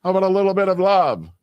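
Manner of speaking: loud, high mock plea; friend demanding love. Friend mock-demanding affection from the host, loud high plea. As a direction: whine it out big and loud. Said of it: "And he goes".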